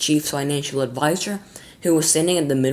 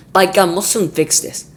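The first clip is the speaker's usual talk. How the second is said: snapping; 5 semitones higher, 6 dB louder than usual. Acted. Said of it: "snapped the president".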